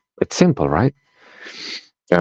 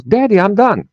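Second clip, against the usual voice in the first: put-on high childish chirp of a little daughter; little girl voice. Daughter telling daddy she's finished, high little girl voice. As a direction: play the small girl proudly announcing.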